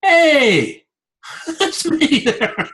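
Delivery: high, startled "hey, that's me" with a laugh breaking in; catching yourself on screen and laughing; surprised laugh. Retold or imitated. Spotting himself in a promo video, surprised and laughing. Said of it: "and I looked and I go".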